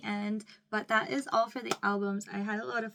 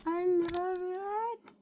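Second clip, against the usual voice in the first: gushing; 10 semitones higher, much slower than usual. Real, not acted. Gushing over photocards.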